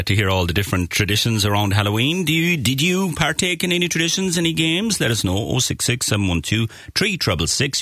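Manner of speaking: ordinary talk, nothing marked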